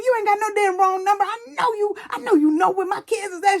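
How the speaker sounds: high-pitched, acted-out accusation; accusing mama. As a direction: imitate a mama calling out a liar, high and insistent. Mama accuses caller of lying.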